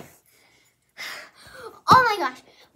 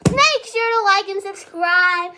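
loud outro; 3 semitones higher, 8 dB louder than usual. Real, not acted.

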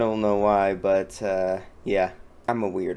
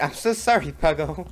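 higher-pitched, not-quite-sorry apology; insincere apology; 5 semitones higher than usual. Real, not acted. Player to the teammate just hit, insincere apology. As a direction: apologize as if you don't really mean it. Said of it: "I can hear it in your voice".

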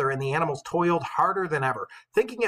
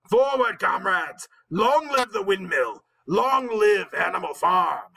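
slow, raised, drawn-out slogan shouts; rallying cry; 5 semitones higher, 4 dB louder, slower than usual. A narrator's reading. Napoleon ending his speech to the animals, shouting slogans. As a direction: chant it out to stir the crowd. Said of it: "cried Napoleon".

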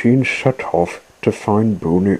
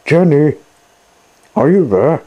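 raised, searching call; 7 semitones higher, 5 dB louder than usual. A narrator's reading. Machines looking for Jenny at the shed, calling out. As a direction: call out, higher and louder. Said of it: "the machines called".

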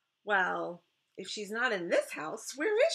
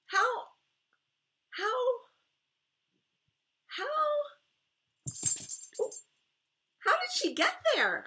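stunned; 4 dB louder than usual. Acted. Shocked to see lost doll.

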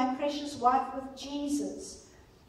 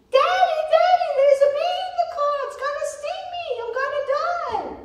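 put-on high, frantic child's cry to her dad; child shrieking about a bee in the car; panicked little girl; 12 semitones higher, 5 dB louder, faster than usual. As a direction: squeal like a terrified little girl stung by fear. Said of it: "She screams".

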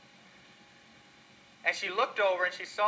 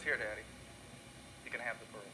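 put-on tearful whisper-soft girl; 5 semitones lower, 12 dB quieter than usual. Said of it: "She says".

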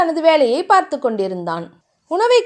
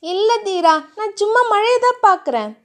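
put-on higher, innocent pleading girl; 7 semitones higher than usual. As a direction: protest sweetly, all innocence. Narrator voices Thiya.

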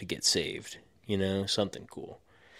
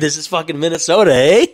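put-on playful Minnesota accent, higher and louder; mock Minnesotan twang, high and loud; 7 semitones higher, 12 dB louder than usual. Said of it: "Is that a Minnesota accent?".

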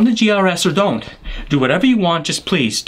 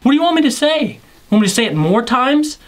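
exasperated; 6 semitones higher than usual. Real, not acted. Exasperated outburst.

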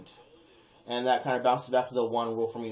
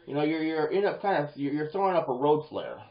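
ordinary talk

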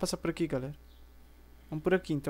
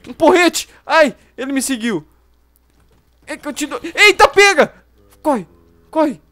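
being chased; 6 semitones higher, 12 dB louder than usual. Real, not acted. Zombie following him.

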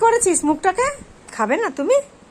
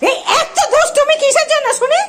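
laughing scold; 9 semitones higher than usual. Real, not acted. Scolding the naughty pet.

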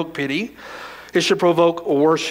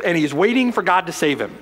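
usual speaking voice

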